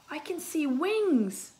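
high, drawn-out marvelling at the find; excited. Acted. Mina spots wings in the second aquarium, excited. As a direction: stretch it out with excited wonder.

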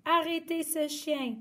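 high call to stop; shouting. Acted. Guy and the girl yelling at the dog, high call. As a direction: call out together. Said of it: "ont-ils crié".

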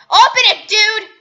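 frustrated yell at a teammate; frustrated shout. Real, not acted. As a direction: shout it out in exasperation. Frustrated yelling at a stuck player.

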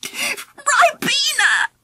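high, slow word forced out through giggles; spluttering with laughter. Acted. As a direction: try to say it but keep cracking up. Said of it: "Angela managed to splutter".

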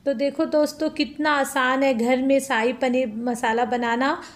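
ordinary delivery

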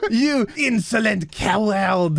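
laughing mock French accent; hammed-up French accent, drawn-out insult. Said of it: "The French language".

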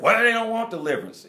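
high, loud put-on protest of a reluctant spouse; mock objecting spouse. Preacher voicing a reluctant spouse, mock loud protest.

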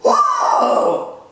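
very high-pitched "whoa"; sudden falsetto outburst; exasperated whoop. Real, not acted. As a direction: let out a squeaky, high cry of disbelief. Exasperated outburst after disgraceful comment.

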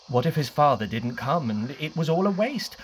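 usual speaking voice

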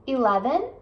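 normal talking voice

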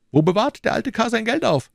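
höher gesprochener, drängender McFarland; überredet den Freund mit Nachdruck; McFarland, pushing hard. Acted. McFarland voiced. (McFarland drängt Fettes, höhere eindringliche Stimme)

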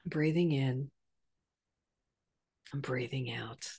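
very soft, low, fading murmur; guided breathing cue. Shown as a demonstration. Teacher reminding viewers to breathe anywhere, very softly. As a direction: let it fade almost to nothing. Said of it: "and think".

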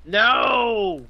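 loud, high drawn-out cry; high wail of protest; dismayed yell. Real, not acted. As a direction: yell out in defeat. Outburst as attempt fails.

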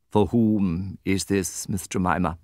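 slow, cold and measured; awful coldness. A narrator's reading. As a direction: chill every word, slowly. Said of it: "with awful coldness".

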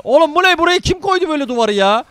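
loud, high shocked outcry at the wall; shocked outburst. Real, not acted. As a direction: yell in disbelief at a sudden wall. Gamer shocked at enemy wall. (şaşkın, yüksek sesle isyan)

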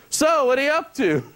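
high, casual question; a hint of a chummy guy; lightly voiced stranger. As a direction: chat up a stranger, pitched high.

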